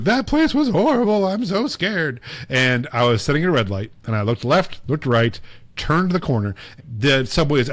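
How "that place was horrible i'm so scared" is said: high, wailing fake fright to mom; mock scared whine. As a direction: whimper it like a scared kid calling home. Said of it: "and was like".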